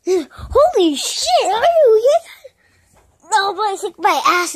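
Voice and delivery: loud, high, slow, laughing through the words; loud laughing disbelief; laughing shout. Real, not acted. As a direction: crack up while yelling. Laughing after a fall.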